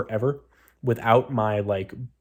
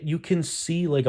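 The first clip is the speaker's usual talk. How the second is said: usual speaking voice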